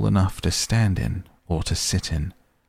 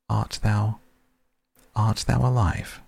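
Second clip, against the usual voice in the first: higher, hesitant ghostly question; hesitant faint ghost child; 4 semitones higher than usual. Acted. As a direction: ask timidly as a lost ghost child. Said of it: "hesitant and so faint".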